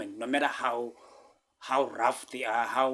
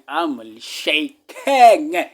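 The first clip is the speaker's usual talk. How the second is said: drawn-out shaking; 2 semitones higher, 11 dB louder, much slower than usual. Real, not acted. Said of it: "I'm literally shaking".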